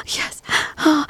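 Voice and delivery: ordinary talk, nothing marked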